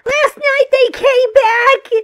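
slow, loud, desperate cry; panicked shout. Acted. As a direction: yell it out in terror. Terrified caller on phone.

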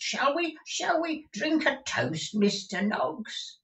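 put-on high, slow wheedling old miser; old Gride. Old miser urging Newman to toast, high and slow. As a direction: coax it out, old miser playing host.

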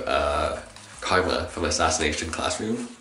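ordinary talk, nothing marked

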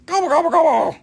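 high, loud mock turkey gobbling; turkey gobble. Host jokes turquoise sounds like turkeys, high gobbling.

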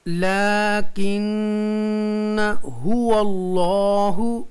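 लाकिन हुवल्लाहु slow, with long held n and aa; recitation demo. Shown as a demonstration. Teacher shows students the reading, drawn-out लाकिन हुवल्लाहु. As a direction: chant लाकिन हुवल्लाहु in sustained syllables. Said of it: "ज़ैद अलिफ को नहीं पढ़ेंगे".

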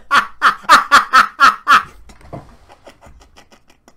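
loud, high burst of laughter; uproarious laugh. Real, not acted. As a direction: crack up loudly and helplessly. Cracks up at comedian's joke.

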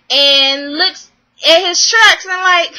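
ordinary talk, nothing marked

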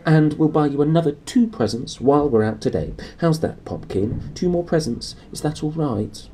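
high coaxing mother; placating mother. Acted. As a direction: soothe a spoilt child quickly. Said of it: "she said quickly".

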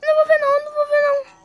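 high, loud frightened protest; scared shouting. Real, not acted. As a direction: yell it, too scared to look. Scared, refusing to look.

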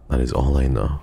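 usual speaking voice

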